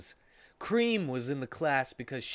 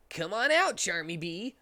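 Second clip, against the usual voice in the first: higher, put-on hero voice; Sonic character voice; 6 semitones higher than usual. Narrator voicing Sonic to friends, higher hero voice. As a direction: voice Sonic rallying the group.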